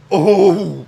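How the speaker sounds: sudden loud "Oh!" of delight; excited gasp. Real, not acted. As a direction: blurt it out, thrilled. Sudden excited reaction to card.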